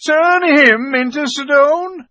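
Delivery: very high, loud, drawn-out echo; incredulous mocking exclamation; scoffing disbelief. A narrator's reading. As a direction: repeat it as utterly ridiculous. Beaver scoffs at Edmund's idea.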